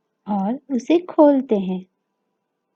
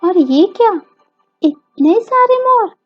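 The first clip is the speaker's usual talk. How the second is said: high, louder burst of wonder; delighted surprise; 9 semitones higher, 6 dB louder than usual. Acted. Showing children so many peacocks in the garden, amazed surprise. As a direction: exclaim in amazement for young listeners.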